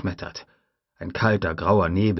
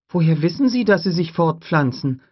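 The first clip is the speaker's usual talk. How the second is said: put-on higher, louder boy's question; an eager kid asking; young boy voice; 10 semitones higher, 5 dB louder than usual. Tim asks Grant about breeding, high boy voice.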